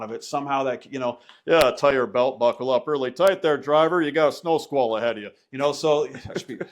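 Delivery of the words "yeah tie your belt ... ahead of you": teasing other drivers over the CB in the trucker voice; mock trucker radio voice. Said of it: "that's how we would start talking on the radio".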